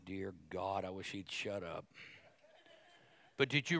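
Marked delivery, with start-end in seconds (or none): 0.0-1.8 much softer, lower, muttered under the breath; a bored man in the pew, hushed and fed up; mock grumbling aside. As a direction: grumble it to yourself so the preacher can't hear. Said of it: "some men are going".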